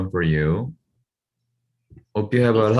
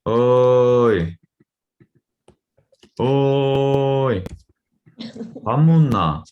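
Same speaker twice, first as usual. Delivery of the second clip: Gyeongsang dialect greeting; 4 semitones higher, 4 dB louder than usual. Shown as a demonstration. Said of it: "So we say like".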